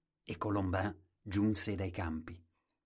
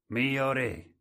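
loud, higher call; loud and clear; 5 semitones higher, 7 dB louder than usual. Acted. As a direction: announce it to the whole court. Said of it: "disse chiaro e forte".

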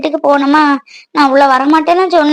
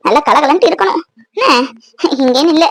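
ordinary talk, nothing marked

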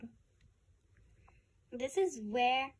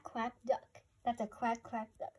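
usual speaking voice

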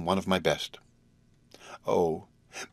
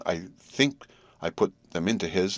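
ordinary delivery, nothing marked

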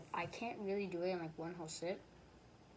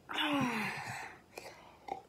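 sigh; 7 semitones higher than usual. Real, not acted. Tired sigh between chugging.

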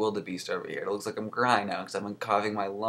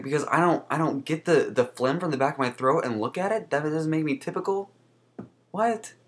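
incredulous retort; 7 semitones higher than usual. Retold or imitated. Her retort to the doctor, retold.

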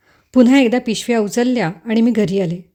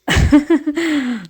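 a light amused chuckle; amused laugh; 5 semitones higher than usual. Acted. Young man amused by the girl's excuse, light chuckle.

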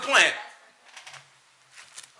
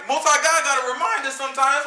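ordinary talk, nothing marked

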